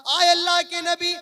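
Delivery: loud, declaiming sermon with drawn-out phrase endings; full-force preaching. Acted. Preacher warning his brothers of punishment in hell, preaching at full force. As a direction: thunder out a grave warning to the congregation.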